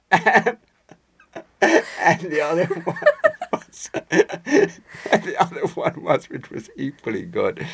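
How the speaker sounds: slow words broken by laughter; laughing while talking. Real, not acted. Recalling the next joke, slow laughing speech. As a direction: keep laughing through the line.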